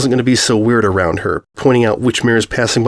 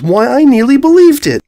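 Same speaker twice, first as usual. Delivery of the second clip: much higher and louder, gleeful mock praise; mother loudly teasing her daughter's staged kiss; delighted teasing; 6 dB louder than usual. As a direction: cheer the bad act like a proud mom. Mother teasing after fake kiss.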